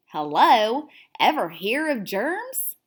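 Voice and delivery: usual speaking voice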